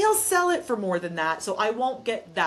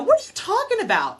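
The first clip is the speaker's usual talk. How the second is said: much higher and louder, a disbelieving retold exclamation; incredulous outburst; 13 semitones higher, 6 dB louder than usual. Telling the client who wants to sell, in retold disbelief. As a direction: replay her shocked reaction to the client. Retelling her reply to client.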